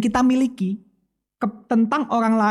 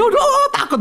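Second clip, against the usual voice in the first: much higher, hammed-up frightened cry; mock fright; 4 dB louder than usual. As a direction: squeak it out like a startled lady. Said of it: "Langsung ketakutan".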